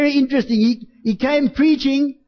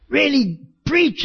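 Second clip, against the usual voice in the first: ordinary delivery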